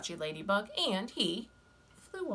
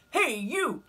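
high, loud, grumpy bug; grouchy ladybug voice; 6 semitones higher, 6 dB louder than usual. Acted. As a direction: bark the challenge up high. Ladybug challenges hyena.

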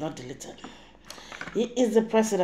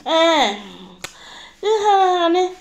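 high, loud, laughing mock endearment; mocking a home-wrecker's pet name; mock sweet talk; 10 semitones higher, 10 dB louder, much slower than usual. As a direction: ham up a flirt's cooing honey. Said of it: "dey call sweet name".

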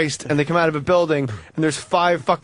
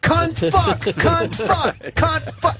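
Davey Mack yelling; 10 semitones higher, much slower than usual. Retold or imitated. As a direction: imitate an angry curse chant, pitched way up. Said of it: "he's yelling".

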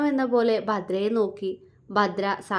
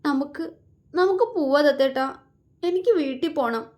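higher, faltering plea on the verge of tears; girl begging her beloved to take her home, tearful; lips trembling; 5 semitones higher than usual. A narrator's reading. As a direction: beg to go home, voice about to break. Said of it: "ചുണ്ട് വിതുമ്പിക്കൊണ്ട് പറഞ്ഞു".

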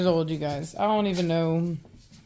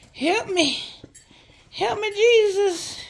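high, rising cry for help, half laughing; buried under dogs, calling for help in mock despair; high mock plea; 9 semitones higher than usual. Real, not acted. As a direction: beg to be rescued, hammed up.

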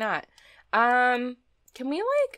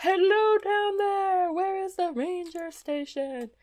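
higher, slower playful hail; sing-song calling; 9 semitones higher, slower than usual. Pretend. Player hailing whoever is at the ranger station, light sing-song call. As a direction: lightly sing out to someone below.

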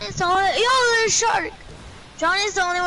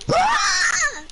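high, drawn-out exasperated cry; mad yell. Retold or imitated. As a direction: retell it as a high outraged wail. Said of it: "I was so freaking mad".

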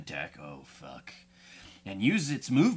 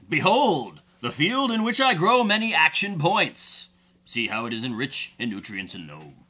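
grand proclamation; 3 semitones higher, 4 dB louder than usual. Pretend. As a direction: proclaim it like a mock-epic orator, settling at the end. Grandly declaims the card he drew.

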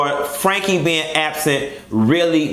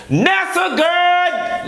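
very high, slow, stretched shout of her name; shouted greeting; 11 semitones higher, much slower than usual. Acted. Calling out to her friend Nessa, in a high, slow shout. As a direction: belt her friend's name out, slowly drawing it out.

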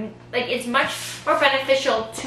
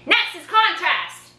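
yelling; 7 semitones higher, slower than usual. Shown as a demonstration. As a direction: call it out to grab attention. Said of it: "I'm yelling at you in my teacher voice".

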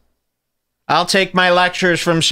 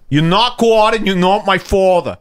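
mock New York accent, higher pitched; 5 semitones higher than usual. Host mocking the accent he despises, New York accent. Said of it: "I despise the New York accent".

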